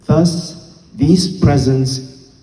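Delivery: slow, deliberate verse reading with pauses between phrases; measured recital, each phrase set apart and weighted; poem recitation. Acted. Poet reading a sonnet to an evening audience, slow formal recitation.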